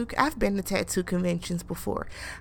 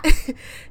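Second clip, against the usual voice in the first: laugh; 8 semitones higher, 12 dB louder than usual. Real, not acted. Laughing at Van's reply.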